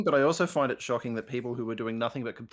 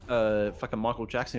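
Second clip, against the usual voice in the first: ordinary talk, nothing marked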